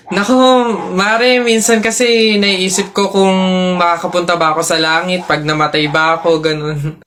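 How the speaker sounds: low, downcast confiding; sad. Acted. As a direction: confide a gloomy worry to a friend. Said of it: "ba't parang ang lungkot mo".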